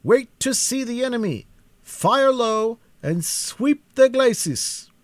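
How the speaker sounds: high, loud, slow shouted orders; battle orders. A narrator's reading. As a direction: bark it out over the battlefield. Commander ordering troops in battle.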